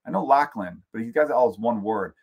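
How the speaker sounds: ordinary talk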